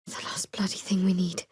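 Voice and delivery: whispered under the breath. Acted. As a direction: hush it like a bitter aside. Muttered aside in danger.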